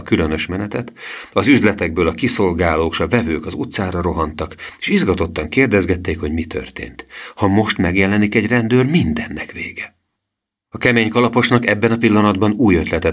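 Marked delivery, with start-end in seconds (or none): none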